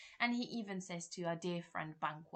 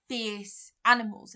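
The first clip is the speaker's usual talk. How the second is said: usual speaking voice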